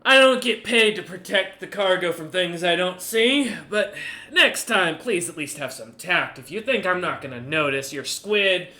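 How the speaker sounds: loud, high-pitched haughty merchant; Goose the merchant. Acted. Merchant captain lecturing the party, loud and haughty. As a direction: lord it over them as the proud merchant.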